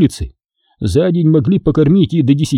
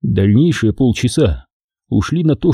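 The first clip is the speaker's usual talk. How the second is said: usual speaking voice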